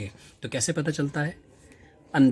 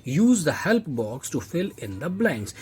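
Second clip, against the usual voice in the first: slow reading; 4 semitones higher, much slower than usual. Shown as a demonstration. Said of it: "अब वो next कहता है".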